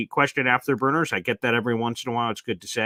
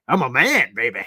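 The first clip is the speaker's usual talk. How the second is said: mock macho; 5 dB louder than usual. Mock protest at nickname.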